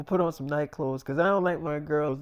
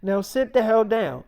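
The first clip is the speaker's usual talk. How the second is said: louder, higher rant; scolding mom; 5 semitones higher, 7 dB louder than usual. Retold or imitated. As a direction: chew the kid out. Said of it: "she was like".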